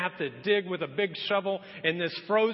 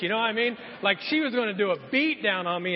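normal talking voice

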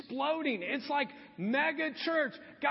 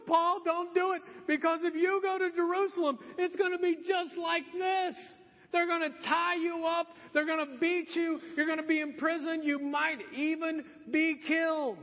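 high, raised-voice prophet's warning; urgent warning; 6 semitones higher than usual. Retold or imitated. Prophet Agabus warning Paul off Jerusalem, high urgent cry. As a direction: cry out a dire warning, pitched up.

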